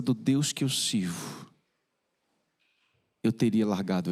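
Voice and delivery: somber preaching. Acted. Preacher's slow, sorrowful confession. (pregação lenta e grave)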